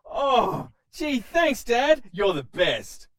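put-on eager young son; bouncy teen thrilled with a gift; excited kid voice. Joseph voiced in skit.

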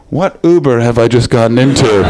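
loud, low, incredulous outburst; mock bewilderment. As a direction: blurt it out in comic disbelief. Acted bewildered reaction to driver.

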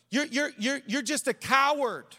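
high, stammered scolding; Dorothy. Acted. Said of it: "Dorothy says to him".

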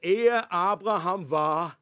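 slow, weighty proclamation; solemn, drawn out. Acted. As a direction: stretch each word towards the punchline. Jesus's climactic saying quoted.

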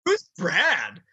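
very high, hammed-up jealous panic; mock hysterics. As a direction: wail the questions in comic disbelief. Continues jealous phone snooper act.

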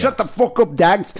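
high-pitched mock Irish outburst; mock Irish accent. Host mimicking the hard-to-understand caller, high mock Irish accent. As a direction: snap it out in an imitated thick Irish accent. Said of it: "you couldn't understand what he was saying".

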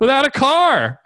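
high, slow, drawn-out punchline; indignant comeback. Retold or imitated. Buyer lands his retort on dealer, indignant sarcasm. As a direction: stretch it out in high-pitched disbelief.

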